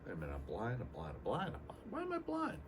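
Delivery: hushed, whiny self-pity that climbs to a squeaky wail on the last question; mock woe is me, quietly; mock pity party. Feeling sorry for himself at the airport, hammed-up whine. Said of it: "having a [name] pity party".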